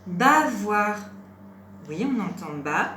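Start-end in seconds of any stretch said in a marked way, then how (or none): 0.1-1.0 loud, deliberate teacher's model word; syllables held evenly and loudly; word dictation. Shown as a demonstration. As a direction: project each syllable clearly for a learner.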